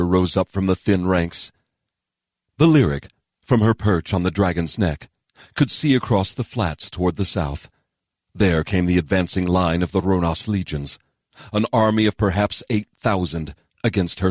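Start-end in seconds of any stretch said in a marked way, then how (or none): none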